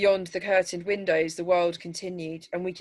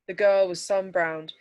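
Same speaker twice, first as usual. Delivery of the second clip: plain reading voice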